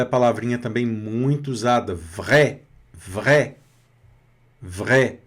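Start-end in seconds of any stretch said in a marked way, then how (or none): 1.9-5.2 vrai with a throaty French r and open è; vrai, guttural r. Shown as a demonstration. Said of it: "o R na garganta".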